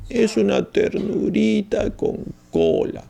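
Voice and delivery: put-on high, slow, sugary baby talk to a puppy; doting pet lover. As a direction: coo over the puppy, drawn out and high. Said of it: "se ama con mucha ternura". (voz alta y lenta de quien mima a un perrito)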